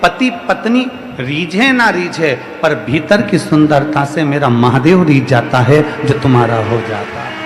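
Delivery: rising, rhythmic sermon delivery; preaching. Acted. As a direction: preach it to the crowd with swelling repetition. Guru preaching to devotees. (प्रवचन की लय में उपदेश)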